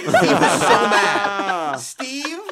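can't stop laughing. Real, not acted. Laughing while telling this.

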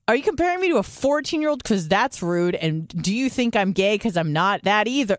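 quick, high-pitched comic protest; indignant retort. Retold or imitated. Retold indignant reply to nurse.

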